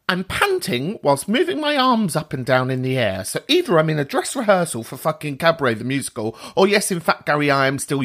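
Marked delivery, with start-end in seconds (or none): none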